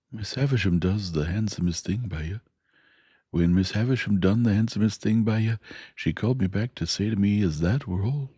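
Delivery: kindly, ponderous working man reasoning it out; Joe the blacksmith. Acted. Joe voiced in story.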